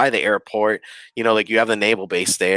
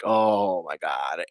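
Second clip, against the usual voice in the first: stunned hush; 5 semitones lower, 6 dB quieter, much slower than usual. Retold or imitated. Hears bathroom light wasn't cousin.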